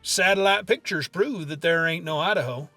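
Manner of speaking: normal talking voice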